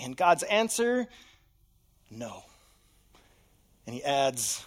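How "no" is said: a lower, much softer flat no; hushed divine refusal. Acted. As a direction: answer like God, calm and final. God's answer voiced.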